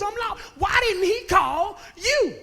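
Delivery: ordinary talk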